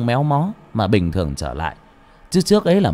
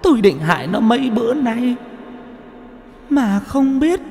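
much higher, whimpering ghost woman's voice; sniffling ghost; 12 semitones higher than usual. A narrator's reading. Ghost begs the exorcist for help, high and tearful. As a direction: plead through sniffles like a wronged spirit. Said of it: "Bà chuột thút thít".

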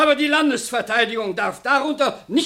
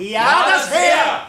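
shouted agreement; 3 semitones higher, much slower than usual. Acted. Heckler shouts agreement at meeting.

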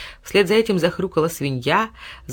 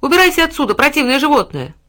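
put-on higher, louder angry boy; angry shout; 8 semitones higher, 7 dB louder than usual. A narrator's reading. Boy driving the dog away, loud angry shout.

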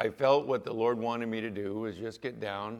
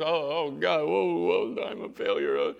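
hammed-up panicked groaning; mock dismay; 6 semitones higher, 7 dB louder, slower than usual. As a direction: wail in exaggerated self-reproach. Preacher mimics his own dismay.

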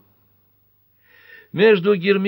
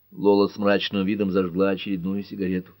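plain reading voice

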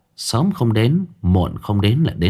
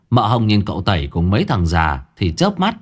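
usual speaking voice

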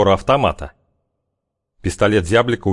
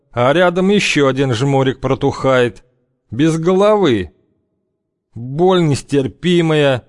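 мрачный бывалый главарь; 7 semitones higher than usual. A narrator's reading. Главарь рассказывает о смерти.

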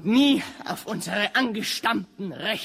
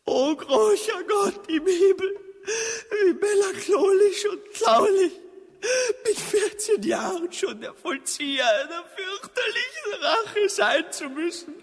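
melodramatic sobbing; 9 semitones higher than usual. Acted. Orphan prince crying to heaven, hammed-up sobbing shout. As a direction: howl the lament theatrically. Son laments, sobbing loudly.

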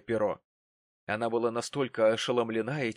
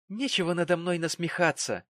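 higher-pitched resentful retort; offended, angry rebuke; spiteful; 6 semitones higher than usual. A narrator's reading. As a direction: answer back with spite. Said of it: "глядя на него со злостью".

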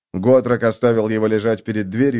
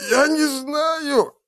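slow, very high squeal; choked wheeze; much slower than usual. A narrator's reading. Captive being choked by the slayer, drawn-out high wheeze. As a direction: squeal it out slowly, throat squeezed. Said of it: "просипел мужчина".

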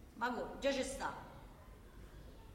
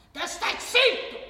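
higher, louder, slow "te stai zitto!"; shouted refrain; 7 semitones higher, 7 dB louder, slower than usual. Acted. Worker ranting about silenced workers, shouted slowly. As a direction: hammer the refrain home. Rhetorical rant.

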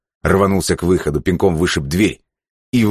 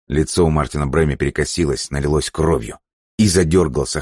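plain reading voice

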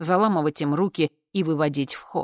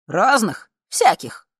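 raised, louder, proud declaration; proud, higher and punchy; proud announcement; 5 semitones higher, 6 dB louder, slower than usual. A narrator's reading. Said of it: "гордо объявил С".

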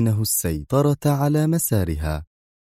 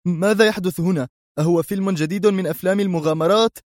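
put-on higher, quicker panicked young man; startled voice of a young man; alarmed cry; 9 semitones higher than usual. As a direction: blurt it out in alarm. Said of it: "هتف في ارتياع".

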